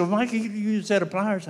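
rising exasperation on the phone; exasperated. Retold or imitated. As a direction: push the advice out quickly, losing patience. Exasperated retold phone advice.

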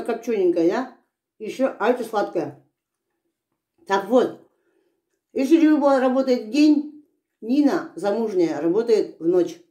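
chewing while talking; talking between bites; mouth full of melon. Real, not acted. Seen 1: Holds melon slice; cheeks bulge, lips pressed, as if chewing between words.

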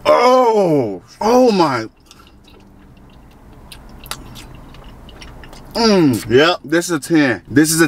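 chewing while talking; speaking between bites; mouth full. Real, not acted. Seen 2: Bites and chews oxtail pieces throughout; mouth working between words.

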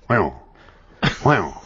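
a low, drawn-out [name] "Wow". Retold or imitated. Cellmate drilling the [name] sound, low wow. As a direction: croon "Wow" low like the King. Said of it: "He goes".

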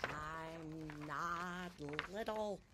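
put-on slow, quiet child's insistence; slow drawn-out refrain. A narrator's reading. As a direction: stretch the words out as if insisting to yourself. Refrain repeated slowly, drawn out.

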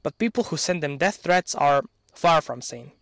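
ordinary talk, nothing marked

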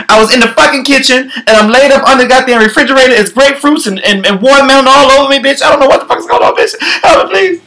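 high, rushed, frantic wail; a hammed-up high panicked plea; mock panic. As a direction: plead like you are lost and scared. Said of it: "[name] be like".

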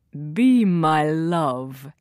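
low, slow prince voice; little prince. A narrator's reading. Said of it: "he says to Thumbelina".

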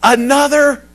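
high, loud retold prayer; anguished plea. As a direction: plead upward to God, loudly. Voices Jesus pleading in Gethsemane.

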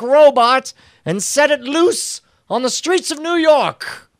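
an absent-minded inventor plutocrat giving orders; hammed-up high James Cameron monologue; James Cameron impression. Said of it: "He's like".

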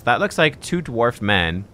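usual speaking voice

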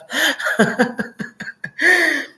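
a bright, high burst of laughter; delighted laugh. Real, not acted. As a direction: laugh out at the surprise. Laughing at her own fascinating reading.

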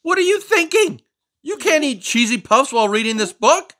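high, loud outraged frog; frog scolding. Acted. Finn the Frog scolds the reader for cheesy puffs, high and loud. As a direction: scold the reader for the messy fingers.

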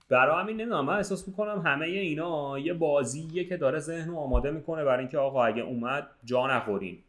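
usual speaking voice

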